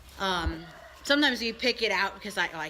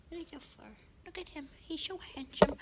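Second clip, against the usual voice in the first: cooing at a pet; 7 semitones higher, 5 dB quieter than usual. Real, not acted.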